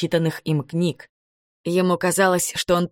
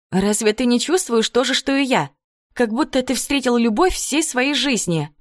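higher, lightly voiced earnest young woman; 7 semitones higher than usual. A narrator's reading. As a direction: voice her eager and earnest. Rebecca voiced by narrator.

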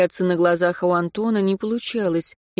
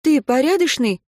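insistent raised question, a bit louder; demanding; 7 semitones higher, 5 dB louder than usual. A narrator's reading. Katya demanding an answer from Anton, insistent. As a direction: press him for an answer. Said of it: "требовательно спросила Катя".

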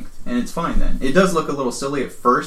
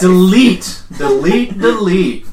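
repeated loud command; 6 semitones higher, 5 dB louder, much slower than usual. Acted. Urging others to forget remark.

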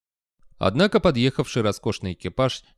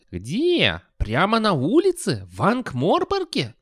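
rising, astonished high voice; surprised old wizard; 9 semitones higher, slower than usual. A narrator's reading. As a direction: ask it in disbelief.

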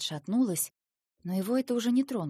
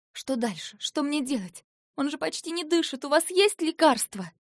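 всё выше и громче к концу; тормошить старика в отчаянии; нарастающая паника; 8 semitones higher, 6 dB louder than usual. A narrator's reading. Героиня в отчаянии торопит старика. (от тревоги к панике)

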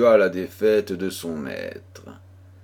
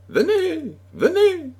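urgent, very high-pitched cry; crying out for help; 4 dB louder than usual. A narrator's reading. Frightened girl at her window calls to the chevalier, crying out. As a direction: cry out high and desperate, calling someone over. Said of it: "et cria".

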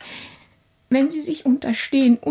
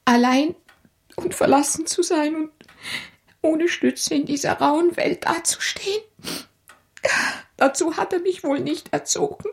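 higher, tearful lament; 5 semitones higher than usual. Acted. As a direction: klag es unter Tränen. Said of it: "weine nicht". (weinerlich klagend)

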